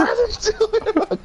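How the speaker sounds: high squeaky denial; mock panicked echo. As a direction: squeal it like a frightened creature.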